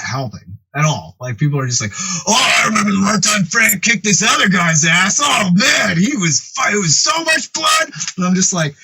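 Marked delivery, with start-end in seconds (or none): 2.3-7.9 high-pitched, hammed-up awe; excited gossip. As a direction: imitate people gushing over a gory tale, voice climbing. Others excitedly share scary Frank stories.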